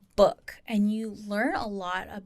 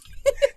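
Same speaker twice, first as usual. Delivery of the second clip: light laugh; 6 dB louder than usual. Real, not acted. Laughing after representation remark.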